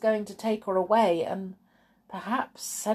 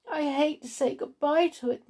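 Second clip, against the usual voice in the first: tearful; 8 semitones higher than usual. A narrator's reading. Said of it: "tears in her eyes".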